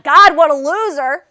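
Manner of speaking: loud, exasperated outburst; angry scorn. Acted. Daughter reacting to ignorant man, loudly scornful. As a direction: burst out in contempt.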